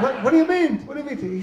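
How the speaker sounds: high-pitched incredulous protest; acted indignant disbelief. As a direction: protest in mock outrage, pitch jumping up. Acts indignant dreamer challenging genie.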